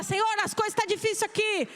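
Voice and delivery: loud, slowing into a shout; loud praise. Shown as a demonstration. The believer calling out to God, a loud slowing praise. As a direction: cry it out to the Lord. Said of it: "abrir a boca e falar".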